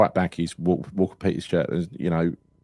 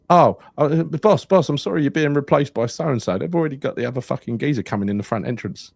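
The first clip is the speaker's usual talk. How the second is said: player's blurted apology; 7 semitones higher, 3 dB louder than usual. Retold or imitated. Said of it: "come up to him and said".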